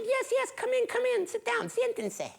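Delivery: very high, fussing hostess; high-pitched, eager little old lady; welcoming old woman. Acted. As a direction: usher a guest in with a high auntie voice. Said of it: "She said".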